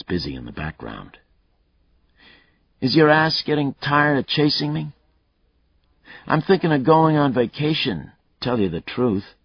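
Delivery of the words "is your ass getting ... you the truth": a lightly voiced cocky killer, higher and louder; taunting killer. Killer Mayle taunting Lucas over the phone, cocky and loud. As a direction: goad the cop, enjoying himself.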